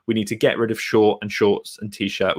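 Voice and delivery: ordinary talk, nothing marked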